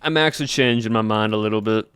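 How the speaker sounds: put-on southern accent. Streamer chatting with chat in a put-on Southern accent. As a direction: keep a Southern US accent going the whole stream. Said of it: "It's a southern accent".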